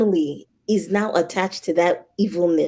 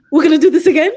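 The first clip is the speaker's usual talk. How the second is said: high, loud incredulous question; exasperated disbelief; 8 semitones higher, 7 dB louder, much faster than usual. Retold or imitated. Traveler challenging the official at the gate, exasperated. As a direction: raise it in disbelief at being stopped.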